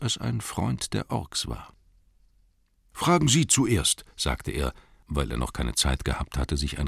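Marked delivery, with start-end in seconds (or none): 2.9-4.1 hobbit voice. Acted. Bilbo voiced in story.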